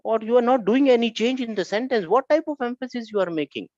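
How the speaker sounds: high-pitched challenge; challenging Inna. Acted. Inna challenges Lam over changing nothing, high and insistent. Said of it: "And Inna was passing from here".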